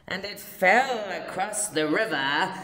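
plain reading voice

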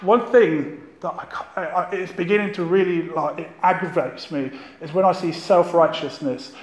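ordinary talk

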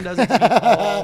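real laugh breaking into the words. Real, not acted. Laughs at his son's habit.

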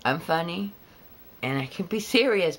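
ordinary delivery

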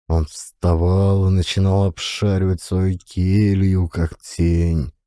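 ordinary delivery

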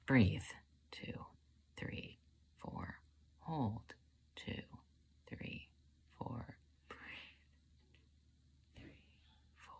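barely audible breath counting with pauses; whispered calming count. Acted. Panicked cook counting breaths to calm down, whispered.

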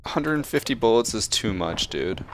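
ordinary talk

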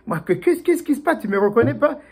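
can't believe he's not recognized; bewildered. Acted. Mouse confused not recognized.

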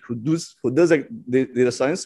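ordinary talk

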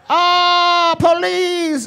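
very high comic wail; hammed-up cry of alarm.

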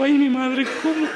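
high, loud shocked laughing exclamation; startled cry. Retold or imitated. Pastor reenacts his startled reaction, high laughing cry. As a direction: gasp it out in mock alarm.

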